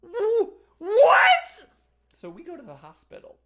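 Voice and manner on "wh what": much higher, much louder horrified shriek; shocked mom. Retold or imitated. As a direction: mom sees the bloody foot and screams. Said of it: "she just says".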